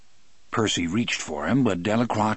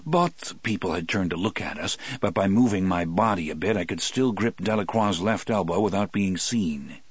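plain reading voice

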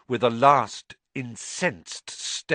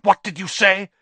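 sharp, louder, higher snap; spat question; 7 semitones higher, 7 dB louder than usual. Acted. Malfoy spitting at his freed servant: sharp and angry. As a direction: spit the words at the elf. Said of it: "spat Mister Malfoy".

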